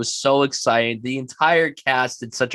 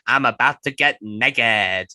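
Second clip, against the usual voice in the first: imitating a film character; 6 dB louder than usual. Retelling his car scene, playful character imitation. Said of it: "He's like".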